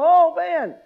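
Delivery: mock starstruck excitement about hanging out with Moses and Elijah; gushing fan. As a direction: gush like a fan meeting heroes. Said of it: "we go".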